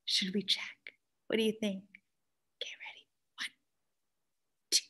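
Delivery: hushed secret whisper. Acted. Whispered suspense to children.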